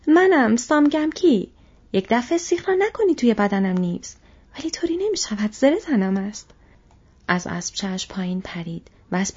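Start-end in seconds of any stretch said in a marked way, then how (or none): 0.1-6.5 shouting. Acted. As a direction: shout your name so nobody strikes. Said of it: "فریاد زد". (فریاد بلند و کشیده)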